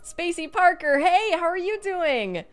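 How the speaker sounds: loud, high-pitched welcome; big high hello; excited greeting. Real, not acted. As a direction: call out delightedly to a regular. Calling greeting to arriving viewer.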